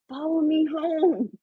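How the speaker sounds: high, drawn-out call to the crows; slow, high-pitched mock yell. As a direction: imitate yelling to birds, pitched high without real volume. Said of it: "I yell at them".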